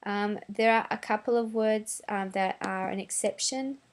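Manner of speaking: ordinary talk, nothing marked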